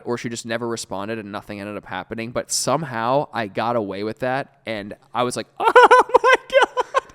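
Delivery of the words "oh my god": very high, loud, drawn-out exclamation; astonished outcry. Retold or imitated. Storyteller reliving getting away with it, astonished high outcry. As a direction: stretch it into a high, disbelieving shriek.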